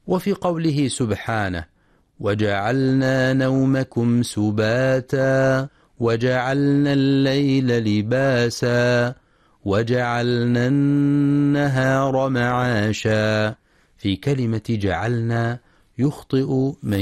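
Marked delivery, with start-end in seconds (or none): 2.2-13.6 slow, measured tajweed chant; Quran recitation. Acted. Teacher reciting the verse to be studied, slow tajweed recitation. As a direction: recite slowly with drawn-out long vowels.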